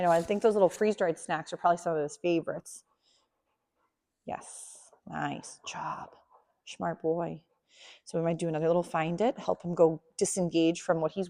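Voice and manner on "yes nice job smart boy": unvoiced whisper of praise; whispered praise so the puppy stays calm. Real, not acted. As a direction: hush it to the puppy.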